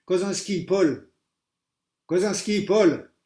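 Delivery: loud high repeated name call; teacher shouting the roll. A narrator's reading.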